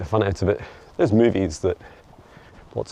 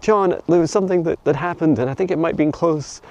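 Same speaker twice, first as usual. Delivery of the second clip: high, concerned imitation of fans; worried viewer voice; 8 semitones higher than usual. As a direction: voice the anxious follower. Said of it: "you're like".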